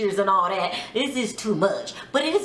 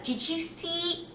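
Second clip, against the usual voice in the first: high, soft, slow imitation; sweet Jasmine; 6 semitones higher, 8 dB quieter, slower than usual. Recapper imitating a sweet castmate, high soft voice. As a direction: put on her sweet little voice, drawn out. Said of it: "she, she talks really".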